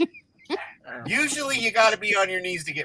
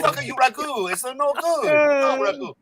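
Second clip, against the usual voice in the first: higher mock Italian gangster accent; hammed-up Italian accent; 8 semitones higher than usual. Mocking a jar sauce in a bad Italian accent. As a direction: ham up a bad Italian tough guy. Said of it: "I can't do an Italian accent".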